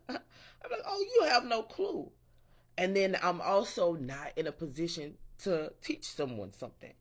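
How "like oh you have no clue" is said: high, laughing squeal of disbelief; laughing mock squeal. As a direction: squeal it through a laugh, mocking.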